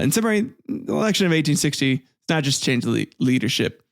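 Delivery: usual speaking voice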